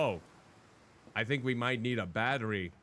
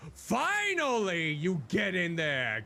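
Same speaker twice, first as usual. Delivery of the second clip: high, slow cry of relief; triumphant relief; 6 semitones higher, 3 dB louder, slower than usual. Real, not acted. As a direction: stretch out the relief. Piece finally fits.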